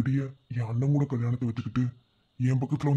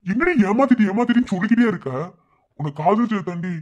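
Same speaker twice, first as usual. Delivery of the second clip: much higher, louder retort; angry outburst; 6 semitones higher, 7 dB louder than usual. Acted. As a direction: snap back at her accusation. Lover snaps back angrily. (கோபமான உரத்த பதிலடி)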